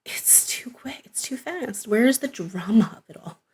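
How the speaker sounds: whispered mock complaint. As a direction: breathe it like a secret. Mock complaint about quick plot.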